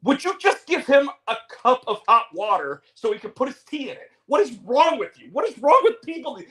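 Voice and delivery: high, exasperated mock woman's voice; exasperated girlfriend. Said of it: "the girl that's with him was, is like".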